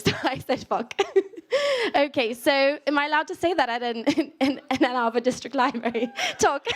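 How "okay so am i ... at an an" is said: usual speaking voice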